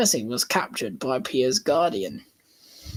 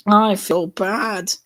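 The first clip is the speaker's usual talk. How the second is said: screeching; 5 semitones higher, 6 dB louder, slower than usual. A narrator's reading. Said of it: "Diesel screeches".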